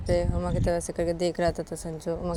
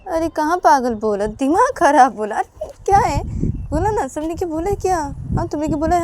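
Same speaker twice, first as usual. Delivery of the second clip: raised, loud arguing voice; exasperated Sanju; 8 semitones higher, 7 dB louder than usual. Acted. Sanju defending his words to Uma, loud exasperation. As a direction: fire back louder and higher.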